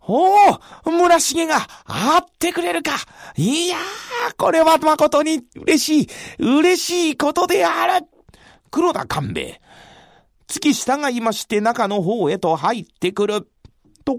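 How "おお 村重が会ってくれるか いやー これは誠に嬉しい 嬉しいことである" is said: delighted. Acted. Kanbei delighted Murashige will meet.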